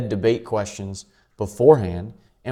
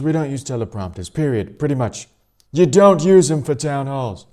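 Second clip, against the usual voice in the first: higher and louder mock Trump rally voice; Trump impression; 7 semitones higher, 6 dB louder than usual. Vlogger imitating Trump at last night's rally, a loud hammed-up impression. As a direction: ham up Trump at a rally, rambling and emphatic. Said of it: "let me do the Trump accent".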